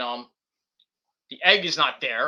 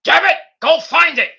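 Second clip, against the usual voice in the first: building to a much higher, louder yell; mock furious boss; 5 semitones higher, 6 dB louder than usual. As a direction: bark the order at the staff. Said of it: "And he's like".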